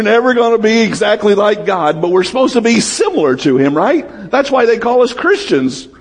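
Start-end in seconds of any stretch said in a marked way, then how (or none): none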